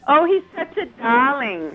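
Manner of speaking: put-on mother's Hungarian accent, lightly done; gushing in a light Hungarian accent. Wife imitating her late mother's Hungarian accent, lightly done. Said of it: "in her Hungarian accent".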